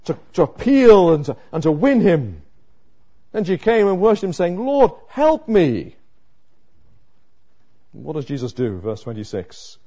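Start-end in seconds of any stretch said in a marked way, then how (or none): 4.6-6.0 slow, higher, drawn-out plea; mother on her knees begging, slow and pleading. Retold or imitated. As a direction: beg again, slower and higher. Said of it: "She's begging the Lord Jesus Christ".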